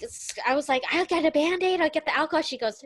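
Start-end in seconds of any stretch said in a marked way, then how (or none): 0.9-2.4 higher, louder, urgent offer of help; re-enacted fretting child; mild panic. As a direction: rush to help, alarmed. Alarmed child offering first aid.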